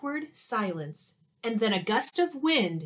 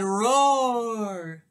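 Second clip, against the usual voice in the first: louder roar in a lightly altered voice; mock bear roar; 5 dB louder than usual. Bear roaring in the picture book, playful. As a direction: give a playful storybook roar. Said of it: "And then the bear said".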